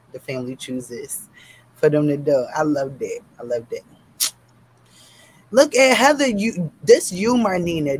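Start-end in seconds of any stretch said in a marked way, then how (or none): 5.5-8.0 loud mock scolding of friends; playful call-out. Real, not acted. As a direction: call them out with amused outrage. Teasingly calling out commenters.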